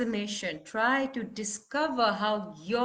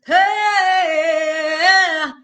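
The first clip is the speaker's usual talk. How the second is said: high, very loud sung-out "hey"; felt angry release; 6 semitones higher, 14 dB louder than usual. Shown as a demonstration. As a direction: release anger in one long open cry. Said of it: "This is not a pushed anger".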